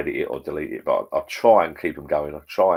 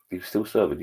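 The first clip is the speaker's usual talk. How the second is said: normal talking voice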